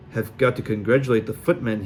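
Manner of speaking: plain reading voice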